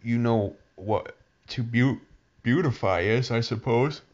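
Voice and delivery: high creature voice, slowed at start. A narrator's reading. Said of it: "it examined".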